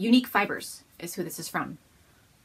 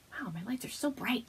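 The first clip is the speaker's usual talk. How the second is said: ordinary talk, nothing marked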